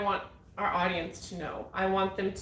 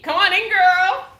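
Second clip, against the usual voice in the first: big, high, slow welcome call; excited welcome; 10 semitones higher, 10 dB louder than usual. Real, not acted. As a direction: sing out the welcome. Host welcomes guest on set.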